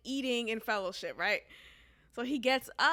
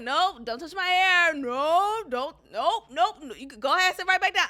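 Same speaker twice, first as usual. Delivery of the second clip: higher and louder, fast playful command; loud mock order; 5 semitones higher, 6 dB louder than usual. Telling the coworker to sit back down, loud playful order. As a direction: shoo him back to his seat. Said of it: "I'm literally saying this".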